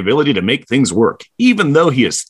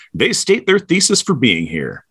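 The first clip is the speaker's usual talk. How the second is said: normal talking voice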